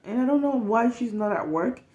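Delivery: normal talking voice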